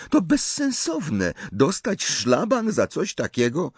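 very high, exasperated objection; disapproving. A narrator's reading. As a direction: dismiss it as senseless, pitch leaping up. Hermiona gani pomysł Harry'ego. (z dezaprobatą, bardzo wysokim głosem)

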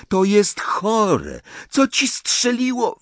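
high, strained exasperation; incredulous outburst. A narrator's reading. Boy rebuking his lovestruck friend, incredulous outburst. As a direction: protest at a high pitch. Shouting quarrel between the friends.

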